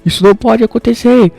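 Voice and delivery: high-pitched hysterical rant, acted out; frantic yelling. A narrator's reading. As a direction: play a man yelling in outraged panic. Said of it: "tava ali gritando horrores".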